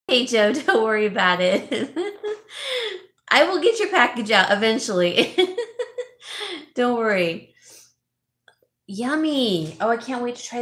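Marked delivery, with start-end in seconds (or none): 1.7-3.0 light, high-pitched giggle; high laugh. Real, not acted. Vlogger telling Joe not to worry, high laugh. 5.4-6.7 high, tickled giggle; high laugh. Real, not acted. Vlogger promising Joe his package eventually, high laugh.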